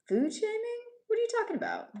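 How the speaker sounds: very high-pitched feigned disbelief; mock shock. Streamer reacting to a chat accusation of food shaming, mock shock. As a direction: squeal it in playful, put-on indignation.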